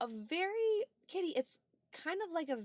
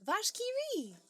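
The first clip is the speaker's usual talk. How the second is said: high excited exclamation; 13 semitones higher, 6 dB louder, slower than usual. Real, not acted. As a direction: blurt it out with delight. Excitedly blurts chat's cheese name.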